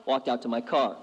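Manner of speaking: usual speaking voice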